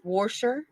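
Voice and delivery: "washer" with an added r, like "warsher"; "washer" as "worsher", rising at the end; regional pronunciation. Shown as a demonstration. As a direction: put an r before the sh in "washer". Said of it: "some people say water".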